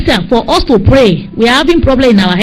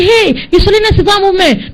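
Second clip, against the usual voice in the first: high-pitched excited call; retold shout; 9 semitones higher than usual. Dreamer announcing the name in the car, shouting. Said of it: "And I shouted in the car".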